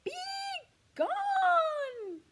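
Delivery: an enormous roar of "Be gone!"; full roar. Acted. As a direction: bellow "Be gone!" with everything. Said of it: "Look at him roaring".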